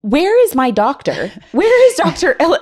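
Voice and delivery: rising panic, calling for him; panicked asking. Retold or imitated. Woman in labor demands her own doctor, high and panicked.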